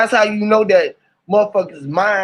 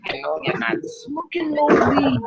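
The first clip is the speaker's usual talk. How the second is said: a hint of a prim scholar, higher and slower; scholarly voice; 7 semitones higher, 4 dB quieter than usual. Shown as a demonstration. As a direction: say it like a fussy scholar. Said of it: "Like a scholar would say it".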